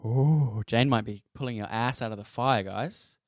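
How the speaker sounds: ordinary talk, nothing marked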